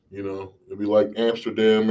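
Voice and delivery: ordinary delivery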